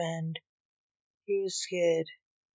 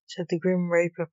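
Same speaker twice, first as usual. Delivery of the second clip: ordinary delivery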